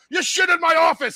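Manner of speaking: high, loud accusation shouted down the phone; imitated angry yelling. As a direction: yell it as the furious dad. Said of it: "he's yelling at him".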